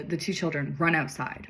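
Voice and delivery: usual speaking voice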